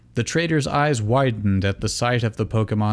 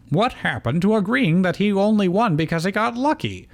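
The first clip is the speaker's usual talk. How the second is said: high voice; 9 semitones higher than usual. Acted. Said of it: "Salon asked".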